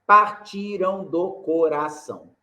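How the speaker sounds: "Partiram do coração" slow, syllable by syllable; scanning syllables. Shown as a demonstration. Teacher scanning the last verse for students, slow. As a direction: spell out the meter gently. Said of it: "Sétima".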